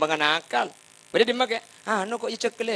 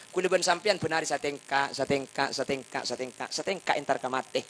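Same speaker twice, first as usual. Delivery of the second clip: normal talking voice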